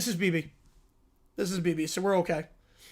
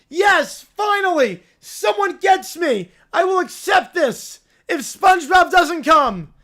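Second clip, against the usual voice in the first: put-on higher, louder gleeful kid yell; bratty triumphant shout; 4 semitones higher, 4 dB louder, slower than usual. Lil Ron Bear finally getting his way, gleeful shout. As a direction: cheer like a spoiled kid who won.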